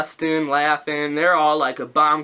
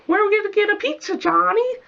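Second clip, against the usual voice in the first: much higher, fast squeaky voice; whiny friend voice. Acted. Friend asking Johnny where to get pizza, high fast voice. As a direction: pipe up like a clueless buddy.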